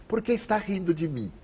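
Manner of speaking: high-pitched indignant mock shout; imitating an annoyed man's yell; retold shout. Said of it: "um senhor gritou da outra fila".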